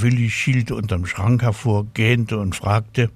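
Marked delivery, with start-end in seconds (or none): none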